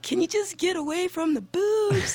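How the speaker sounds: high-pitched mock exasperation; exasperated plea. Viewer complaining at the anime's fan service, exasperated. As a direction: plead in comic exasperation, voice pushed way up.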